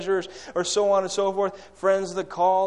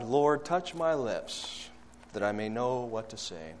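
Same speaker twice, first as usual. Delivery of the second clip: hushed prayer; 7 semitones lower, 8 dB quieter than usual. Acted. Prayer in the closet.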